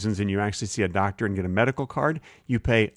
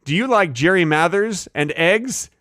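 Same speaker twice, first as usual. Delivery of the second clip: ad pitch; 8 semitones higher, 4 dB louder than usual. Pretend. Host reads sponsor talking points to a pretend customer, hammed-up ad pitch. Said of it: "I gotta read the talking points".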